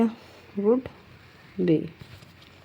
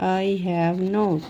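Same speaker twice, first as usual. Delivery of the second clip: each word stretched very slowly; slow dictation; much slower than usual. Shown as a demonstration. Teacher dictating an English phrase for shorthand. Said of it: "तीन को एक बार में".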